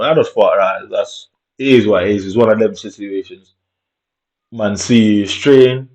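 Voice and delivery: ordinary talk, nothing marked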